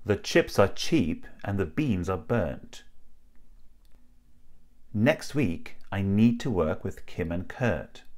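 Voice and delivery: slow, carefully separated model sentences; drill sentences. Shown as a demonstration. Teacher modelling sentences for learners to practice, slow careful enunciation. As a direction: enunciate each vowel slowly and distinctly. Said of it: "a few drill sentences for you to practice".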